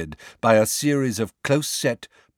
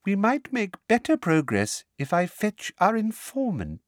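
silky, sweet simper; 10 semitones higher than usual. A narrator's reading. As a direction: purr it sweetly. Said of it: "said Umbridge silkily".

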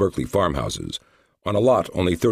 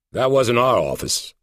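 FBI agent voice; 7 semitones higher than usual. Acted. FBI agent answers coldly.

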